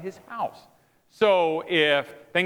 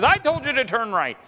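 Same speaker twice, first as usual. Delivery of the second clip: higher, firm mock reprimand; mock scolding; 7 semitones higher, 4 dB louder than usual. Sled master shaking the lead dog, mock scolding. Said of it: "he shakes that lead dog and he says".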